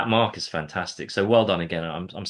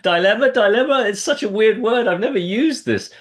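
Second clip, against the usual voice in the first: high, loud, incredulous protest; mock exasperation; 12 semitones higher, 7 dB louder than usual. Coach mimics masterclass students balking at an odd word, mock exasperation. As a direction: imitate baffled students balking, voice pitched way up. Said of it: "people going".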